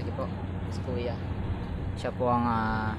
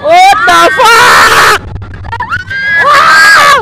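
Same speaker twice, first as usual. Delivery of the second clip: high, drawn-out shrieked curses and whoops; full-voiced thrill-ride yelling; real ride screaming; 6 semitones higher, slower than usual. Real, not acted. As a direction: scream your head off on the ride.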